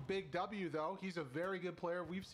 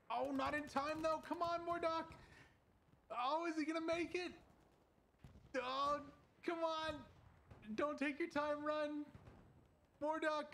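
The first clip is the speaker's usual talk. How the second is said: excited; 10 semitones higher than usual. Real, not acted. Caster watches a tense escape.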